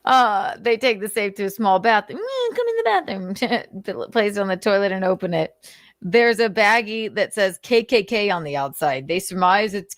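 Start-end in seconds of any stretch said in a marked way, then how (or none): 2.2-3.8 mock seductive invite. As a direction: lure them in like a mock temptress.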